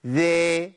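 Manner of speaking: ذا said with a broad smile, drifting toward ذي; wrong demo; wide smile ذا. Shown as a demonstration. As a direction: spread the lips wide on ذا. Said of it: "ابتسامة عريضة".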